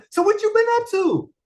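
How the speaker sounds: high, lilting mock catch-up question; high friendly question. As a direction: sing it up high like an old friend.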